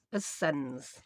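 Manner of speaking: low, soft "As sens", Yorkshire for ourselves. Shown as a demonstration. Crafter teaching viewers a dialect word, "As sens" in low Yorkshire. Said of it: "That's how we say ourselves in Yorkshire".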